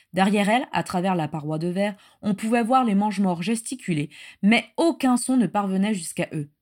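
usual speaking voice

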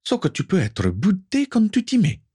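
high-pitched exasperated exclamation, not shouted; exclaiming. A narrator's reading. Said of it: "s'exclama Natalia". (exclamation aiguë, sans crier)

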